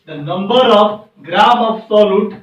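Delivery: very slow, word by word, for writing down; slow teacher dictation. Shown as a demonstration. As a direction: dictate it slowly so they can write. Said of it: "लिखेंगे".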